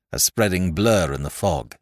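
plain reading voice

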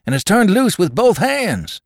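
high, loud alarmed cry; cried out. Acted. Young man at the door warns the saloon, crying out. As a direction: burst in shouting the warning. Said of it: "He cried".

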